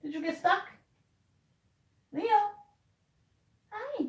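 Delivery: high, soft coo at the cat; pet talk. Real, not acted. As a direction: greet the cat sweetly. Talking to the cat.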